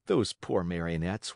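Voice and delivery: ordinary delivery, nothing marked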